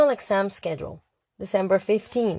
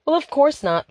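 higher, louder dismissive retort; Lily scoffing; 7 semitones higher, 4 dB louder than usual. Acted. Lily dismisses Mia's silly guess with a scoff. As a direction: brush the idea off briskly. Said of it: "Lily said".

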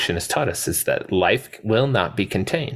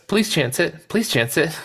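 high, desperate mock begging; pleading Malcolm; 11 semitones higher than usual. Podcaster quoting injured Malcolm, high mock pleading. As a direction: beg them to hurry, pitched high. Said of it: "he goes".

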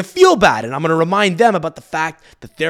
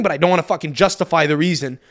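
ordinary talk, nothing marked

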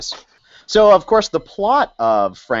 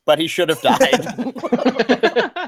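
laughing; 13 semitones higher, much slower than usual. Retold or imitated. Reads review line mockingly.

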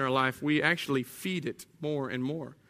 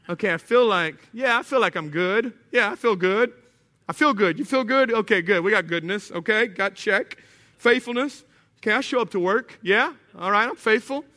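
higher, louder, pleased self-check; mock self-assurance; 4 semitones higher, 4 dB louder than usual. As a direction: play someone happily ticking virtues off a list. Acts out self-congratulating checklist.

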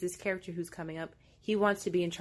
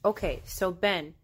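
normal talking voice

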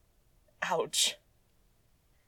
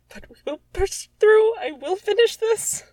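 much higher and louder, mock-heroic resolve; melodramatic vow; 14 semitones higher, 8 dB louder than usual. Real, not acted. Vowing to finish reading her old story, mock-heroic cry. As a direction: vow to push through like a hero.

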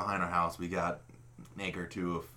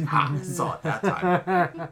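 higher, louder fake sighting; mock excitement; 7 semitones higher, 6 dB louder, much slower than usual. Pretending to spot a snipe for the kid, mock excited. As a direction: call it out slowly like a pretend hunter. Acting out spotting a phantom snipe.

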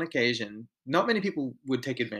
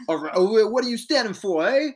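high, loud, hammed-up New York accent; mock the New York boss in a cartoonish New York accent; a self-confessed worst-ever New York accent; bad New York accent; 10 semitones higher, 7 dB louder than usual. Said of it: "New York".

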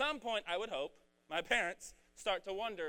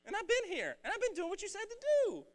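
put-on much higher, fast, whiny older-brother voice; high-pitched whine; 5 dB louder than usual. Preacher voicing the jealous older brother, high-pitched. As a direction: squeak it out like a sulking sibling. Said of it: "you like how I got really high-pitched there".

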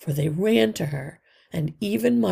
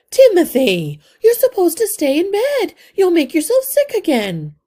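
high, loud worried scolding; scolding mother; 13 semitones higher, 11 dB louder than usual. Acted. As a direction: scold him for getting out of bed. Mother scolds sick son.

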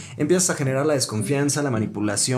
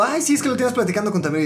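mocking sing-song voice; 7 semitones higher, faster than usual. Mocking the intruding friend.

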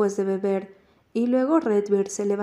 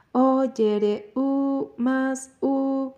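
imitación de gemidos; 3 semitones higher, much slower than usual. Retold or imitated. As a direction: imitate a lover's cries with restraint. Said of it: "Esa chica estaba gritando demasiado alto". (imitación contenida de gemidos, algo más aguda)

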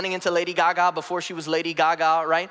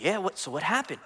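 lightly acted eager listener, softer voice; 5 dB quieter than usual. Friend urging on a storyteller, lightly acted eagerness. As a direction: play the friend drawn into the tale. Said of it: "And you're like".

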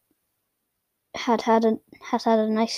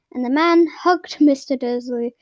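plain reading voice

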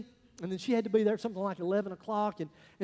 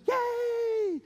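shouted, much higher "Yay!"; mock crowd cheer; 4 dB louder than usual. Congregation cheers back.

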